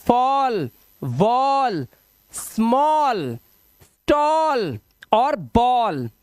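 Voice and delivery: each word stretched very slowly; slow demo words. Shown as a demonstration. Teacher slowly reading word list aloud.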